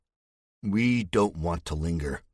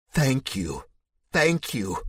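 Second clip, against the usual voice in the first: breathless thanks rising higher; grateful rescued man; 6 semitones higher, slower than usual. Acted. Rescued man thanks his rescuer, tearful and overwhelmed. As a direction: gush thanks, still shaken.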